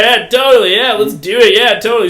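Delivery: loud, high, eager agreement; reenacted phone call, barely contained; failing to play cool. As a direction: rattle off yeses over bubbling excitement. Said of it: "remain, like, cool".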